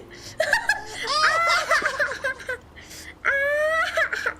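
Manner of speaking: long high shrieking laugh fit; panting dog laugh. Real, not acted. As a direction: laugh helplessly till it cracks. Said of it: "Never again".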